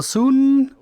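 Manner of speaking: high, loud incredulous echo. Real, not acted. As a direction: throw the word back in disbelief. Balking at NPC's demand.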